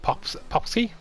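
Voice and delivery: Popsky said as a high, unsure question; unsure name attempt. Real, not acted. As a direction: try out Popsky with a rising, doubtful tone. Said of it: "really struggling with that".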